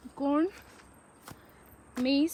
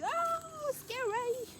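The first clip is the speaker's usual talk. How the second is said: squeal of fright; 14 semitones higher, much slower than usual. Real, not acted. Mosquitoes all over her leg.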